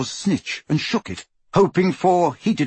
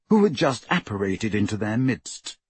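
ordinary delivery, nothing marked

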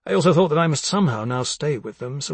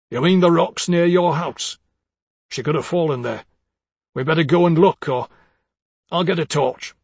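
louder, higher voice for the anxious husband; urgent husband proposing a search; worried husband; 4 semitones higher, 5 dB louder than usual. Acted. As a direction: raise pitch and volume as the worried husband.

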